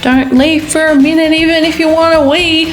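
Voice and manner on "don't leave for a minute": ordinary talk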